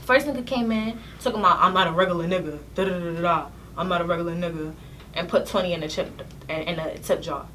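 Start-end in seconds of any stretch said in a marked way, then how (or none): 1.6-4.7 low-pitched mock pickup line; lightly voiced boastful man, pitched down; imitated customer. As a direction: put on a low suitor's patter.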